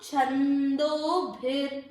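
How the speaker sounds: छंदोभिर said very slowly with the half र joined to भि; slow chhandobhir. Shown as a demonstration. Teacher showing students the joined half r, very slowly. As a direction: draw out छंदोभिर syllable by syllable. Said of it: "तो उच्चारण क्या हुआ?".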